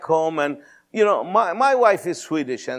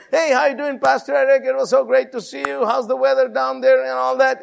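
high, chirpy small talk on the phone; put-on cheery, chatty greeting; breezy phone greeting; 10 semitones higher than usual. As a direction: gush a friendly hello, pitched way up. Acted overly casual phone greeting.

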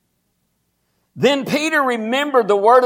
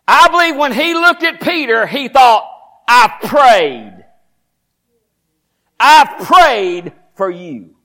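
louder, higher, driving preacher cadence; full-force preaching; 3 semitones higher, 4 dB louder than usual. Acted. Pastor building to a climax before the church, louder and higher. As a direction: preach it hard to the congregation.

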